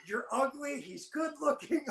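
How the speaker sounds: high, slow teasing insults; mock berating. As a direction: lay the insults on slowly, half laughing. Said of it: "just berating".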